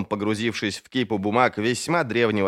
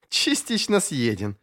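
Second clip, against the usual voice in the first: giggling; 2 semitones higher than usual. A narrator's reading. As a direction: giggle through «Частично съеден». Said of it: "хихикала она".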